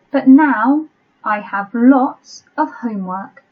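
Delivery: slow, spaced-out dictation reading; measured teacher dictating to a class. Shown as a demonstration. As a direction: read it out slowly with long pauses between phrases. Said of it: "I'll read it slowly".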